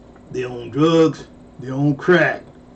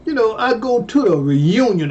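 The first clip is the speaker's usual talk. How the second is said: ordinary delivery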